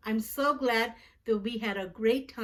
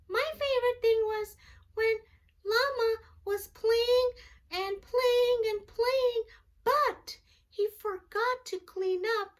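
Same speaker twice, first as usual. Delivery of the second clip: much higher puppet voice for a little friend; Jackson puppet voice; 11 semitones higher than usual. Pretend. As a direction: give the puppet a high childlike voice. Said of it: "our friend Jackson".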